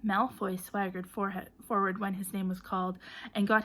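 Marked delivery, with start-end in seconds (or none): none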